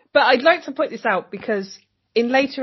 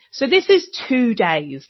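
ordinary talk, nothing marked

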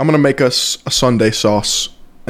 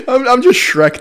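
high, cracking laugh through words; 11 semitones higher than usual. Real, not acted. Laughing at Shrek joke.

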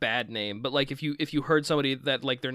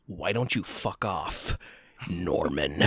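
movie line impression; 8 semitones lower, slower than usual. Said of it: "one of my favorite lines".

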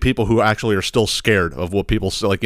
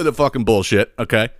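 usual speaking voice

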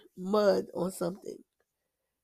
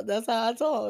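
usual speaking voice

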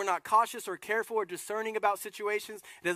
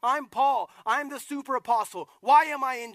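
indignant Paul; 5 semitones higher, 7 dB louder than usual. Retold or imitated. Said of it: "He's like".

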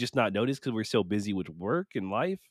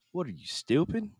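higher, mock disbelief at himself; self-scolding; 6 semitones higher than usual. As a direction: ask yourself if you're stupid, jokingly. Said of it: "I was like".

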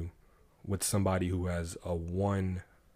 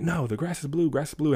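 stubborn donkey; 8 semitones higher, 4 dB louder than usual. Retold or imitated. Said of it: "donkey's like".

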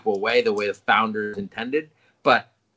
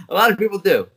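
laughing through the words, louder and higher; 8 semitones higher, 6 dB louder, faster than usual. Real, not acted. Cohost laughing at being compared to Mandela, laughing. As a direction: chuckle out the boast. Joke about Nelson Mandela.

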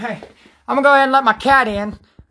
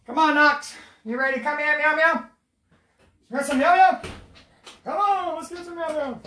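calling the cat; 6 semitones higher, 5 dB quieter than usual. Real, not acted. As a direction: coax the kitty in sweetly.

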